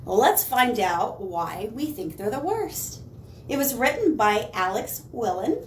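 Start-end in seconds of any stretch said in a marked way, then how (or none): none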